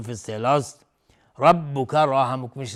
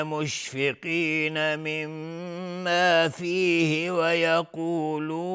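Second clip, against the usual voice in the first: slow, melodic tartil chanting; Quran recitation; 6 semitones higher, much slower than usual. Acted. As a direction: draw out every verse with a flowing chant. Quran recitation before tajweed explanation. (تلاوت آهسته و آهنگین قرآن)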